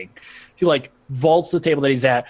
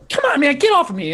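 high, fast protest; protesting Weasel; 12 semitones higher, faster than usual. Acted. Weasel held by Krakow, high fast protesting. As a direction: squawk it quickly while wriggling free.

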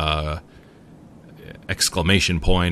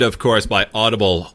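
ordinary talk, nothing marked

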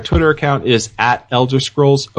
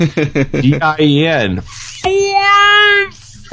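slow spelled letters, then a high yelled Force; dramatic spelled-out shout; 8 semitones higher, much slower than usual. Pretend. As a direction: spell it out slowly, then cry out the last word. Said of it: "do it right".

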